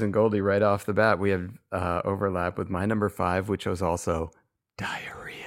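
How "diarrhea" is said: very soft, unvoiced whisper. Shown as a demonstration. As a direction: whisper it like an embarrassing secret. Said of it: "my five whispered words".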